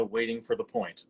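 ordinary talk, nothing marked

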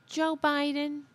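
a milder high impression; mocking Greene. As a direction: caricature her again, lightly. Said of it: "I'm actually making her voice sound better".

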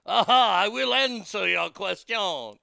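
loud, hammed-up bad French accent as Hunter; a loud, cheesy fake French accent. Vlogger imitates Hunter testifying in a bad French accent. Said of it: "a really shitty French accent".

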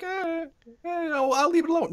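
put-on high-pitched "can't be bothered"; dismissive shrug. Imagining a lazy developer shrugging off background detail, high dismissive voice.

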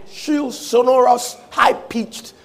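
high-pitched description. Retold or imitated. Describing the demon's shrill noise.

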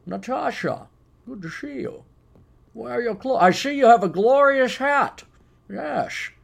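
playful, high-pitched spy impression with exaggerated accent; James Bond. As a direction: greet a lover in a hammed-up Bond accent. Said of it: "you can even put on the accent".

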